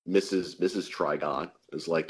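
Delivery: ordinary talk, nothing marked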